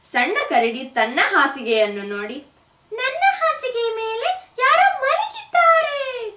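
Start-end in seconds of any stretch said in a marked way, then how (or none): 2.9-6.3 much higher squeaky cub, half laughing; baby bear growl. A narrator's reading. Baby bear finds the girl in his bed, squeaky. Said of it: "ಎಂದು ಗುರುಗುಟ್ಟಿತು".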